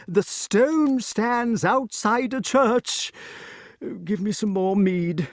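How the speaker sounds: high, slow, laboured explanation; King Pellinore, painstaking. A narrator's reading. Pellinore restarts his account of the sword, high and painstaking. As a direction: set out each fact slowly, pitched high.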